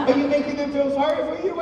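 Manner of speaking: quick, high-pitched mother's accusation; comic high mother voice; accusing mom. Retold or imitated. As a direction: rattle it off as a suspicious mom. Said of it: "She said".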